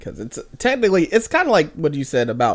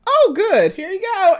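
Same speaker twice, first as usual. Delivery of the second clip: high, louder chirpy mom voice; mimicking breezy maternal approval; imitated cheery mom; 4 dB louder than usual. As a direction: chirp it brightly as an unbothered mother. Voicing cheerful mom's reply.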